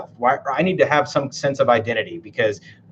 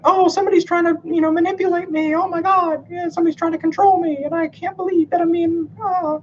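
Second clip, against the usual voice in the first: much higher, squeaky mock whine of an offended person; mocking whiny voice. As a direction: parody them in a high, whiny, wounded voice. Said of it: "which are like".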